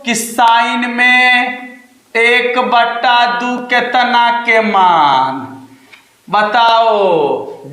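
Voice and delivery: each word stretched out very slowly; teacher spelling out the question word by word; slow drawn-out reading. Shown as a demonstration. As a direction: read it aloud slowly, like dictating to the class. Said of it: "कब, कैसे पढ़ेंगे इसको".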